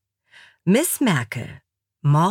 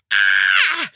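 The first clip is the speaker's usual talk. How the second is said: loud, long scream; exasperated scream; 9 semitones lower, 6 dB louder than usual. A narrator's reading. Alexa drowning out Achim, loud exasperated scream. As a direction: scream over the other speaker.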